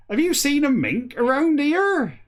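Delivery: high, loud West Country farmer voice. Retold or imitated. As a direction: imitate a West Country farmer, pitched right up. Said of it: "has a great West Country accent".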